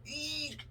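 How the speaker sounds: high, slow strained squeak of effort. Retold or imitated. Prying elevator doors open with his back, squeaking from strain. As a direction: squeak as you pry the doors.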